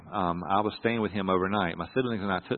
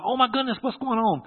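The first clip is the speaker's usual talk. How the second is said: high-pitched panicked cry, retold; inner scream; 13 semitones higher, 3 dB louder than usual. Son panicking inside at his dad's bedside, retold inner scream. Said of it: "Inside, I'm screaming".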